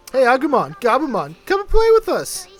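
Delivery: high, bright-eyed call to playmates; eager invitation. Acted. Game character inviting Agumon and Gabumon to play, eagerly. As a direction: call them over to play, pitch jumping up at the end.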